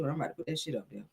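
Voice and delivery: ordinary talk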